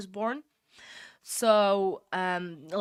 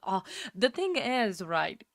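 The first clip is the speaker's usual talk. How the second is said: ordinary delivery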